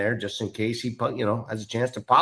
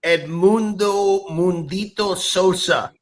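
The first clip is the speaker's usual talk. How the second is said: high, loud, stretched-out player name; hyped name announcement; 10 semitones higher, 6 dB louder, slower than usual. Pretend. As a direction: announce him slowly with a big flourish.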